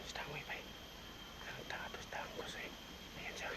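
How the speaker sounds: hushed whisper while spying. Real, not acted. Watching Dango secretly, whispering to the camera. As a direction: whisper so Dango won't hear.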